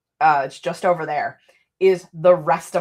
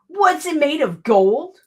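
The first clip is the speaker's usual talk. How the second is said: higher, louder mock disbelief; incredulous; 9 semitones higher, 5 dB louder than usual. Real, not acted. Streamer scoffing at a chat comment about expensive dice. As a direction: scoff at the price. Mocking forty euro dice price.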